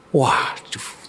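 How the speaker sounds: dismayed whisper; hushed oh no; whispered waduh. A narrator's reading. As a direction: breathe it out, shocked.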